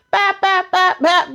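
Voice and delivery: high, sing-song bat bat bat bat; mock hitting sounds. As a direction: beat out each hit like a cartoon punch. Recapping Brayden hitting Lucas.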